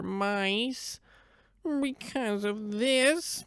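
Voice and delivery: put-on high, slow, mournful old cat voice. The old cat tells the donkey its sorrows, high and slow.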